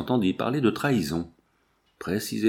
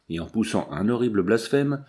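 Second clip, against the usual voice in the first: ordinary delivery, nothing marked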